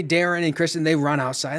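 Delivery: normal talking voice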